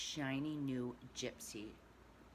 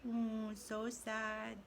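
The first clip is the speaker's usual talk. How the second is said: drawn-out high mock sadness; mock sad; 8 semitones higher, much slower than usual. Teacher to her class, a slow mock-sad coo.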